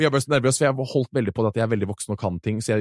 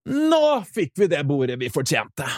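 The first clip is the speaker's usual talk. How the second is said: triumphant exclamation; 4 semitones higher than usual. Retold or imitated. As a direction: announce it like a victory. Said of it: "Og jeg bare". (høy, triumferende)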